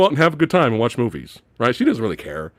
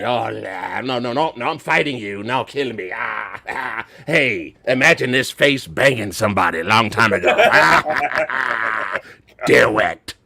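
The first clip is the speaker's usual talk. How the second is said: mock Palpatine; 5 semitones lower than usual. Mocking Palpatine fighting Rey.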